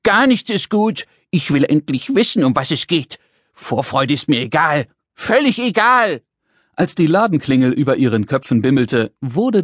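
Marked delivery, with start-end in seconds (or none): 0.0-4.9 little creature in a huff; indignant screeching. Acted. Little man fed up with waiting, indignant screeching. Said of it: "kreischte der Kleine". 5.1-6.2 high, indignant little man's squawk; screeched protest. Acted. Little man rejects the joy of waiting, screeching. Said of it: "kreischte der Kleine".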